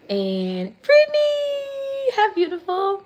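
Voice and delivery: high, loud delighted cry to a viewer; gushing hello to a fan; excited. Real, not acted. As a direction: squeal out a viewer's name. Greeting a viewer on live.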